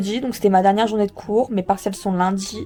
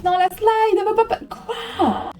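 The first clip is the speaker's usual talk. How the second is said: exasperated wailing; 8 semitones higher than usual. Real, not acted. Student wailing as her slide fails, exasperated. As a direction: wail in dismay, well above your usual pitch.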